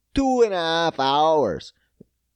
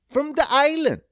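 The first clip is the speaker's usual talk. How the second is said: mock high voice; 12 semitones higher, 4 dB louder than usual. Repeated in a squeaky put-on voice.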